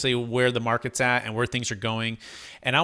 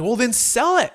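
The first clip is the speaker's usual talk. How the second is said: higher-pitched mock-insistent wife; lightly exasperated, high-pitched retort; retold exasperated urging; 9 semitones higher, 4 dB louder than usual. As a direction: imitate a wife pushing back, pitch jumping up. Wife urging husband in retold argument.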